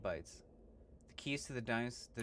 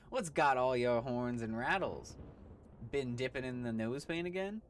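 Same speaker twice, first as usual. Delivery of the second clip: loud hammed-up Western drawl; cowboy voice; 3 semitones higher, 5 dB louder than usual. Gabby greeting a stranger, loud hammy cowboy. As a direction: holler like an old prospector. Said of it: "Gabby doesn't talk like that".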